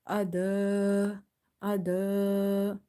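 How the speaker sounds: Ada with its last vowel held long and level; stretched madd. Shown as a demonstration. Teacher shows the alif stretch, long-held vowel. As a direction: chant Ada, sustaining the final vowel. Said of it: "you will stretch up to two and a half alif".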